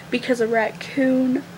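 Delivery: usual speaking voice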